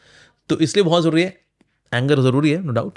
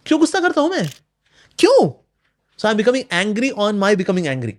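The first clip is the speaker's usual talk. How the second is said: high, exasperated self-scolding, peaking on the second क्यों; angry at oneself; 5 semitones higher than usual. Retold or imitated. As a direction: demand of yourself why you get angry. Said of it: "अपने गुस्से होने के ऊपर गुस्सा करना".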